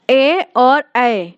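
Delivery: ए और ऐ said slowly, each vowel held; slow vowel demo. Shown as a demonstration. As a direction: stretch ए and ऐ. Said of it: "छोटी ए और बड़ी ए".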